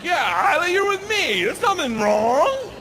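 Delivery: overly cheerful reassurance. Retold or imitated. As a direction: sell it to the kid with a big chipper voice. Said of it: "And I'm like".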